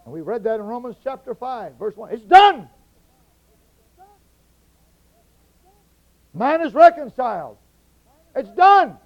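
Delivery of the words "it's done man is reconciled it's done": "It's done" shouted, hard falling end; shouted declaration. Acted. Preacher to congregation, shouting it's done. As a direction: bang it out like a verdict.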